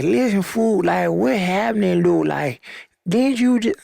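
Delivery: high, hammy put-on country accent. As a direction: ham up a bad country accent. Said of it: "my country accent's probably terrible".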